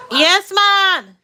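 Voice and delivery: loud, high call across the house; calling out to Mom. Real, not acted. Answering her mother's call from another room, loud and high. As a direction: shout back so Mom hears you from far off.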